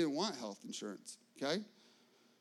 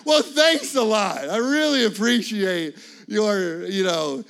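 sarcastic thanks; 10 dB louder than usual. Retold or imitated. Sarcastic reply to preparer.

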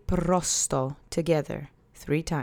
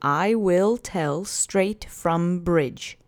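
"I will tell straight from bridge" said very slowly, word by word; slow literal gloss; much slower than usual. Shown as a demonstration. Teacher giving the literal translation, very slow and deliberate. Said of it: "which literally translates to".

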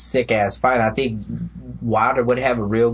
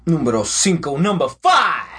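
ring announcer shout; 4 semitones higher than usual. Pretend. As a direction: bellow the number like a ring announcer. Countdown call.

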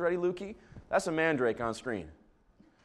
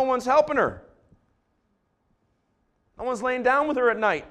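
preaching; 4 semitones higher than usual. Acted. Pastor preaching to the congregation, building then hushed. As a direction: preach it, then soften for the hurting.

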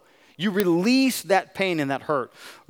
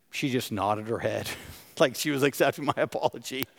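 ordinary talk, nothing marked

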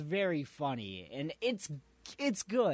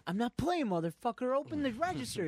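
mock robber threat; 11 semitones higher, faster than usual. Retelling a robber's threat to a cashier, hammed-up shout. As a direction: bark the demand like a jittery robber. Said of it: "He's like".

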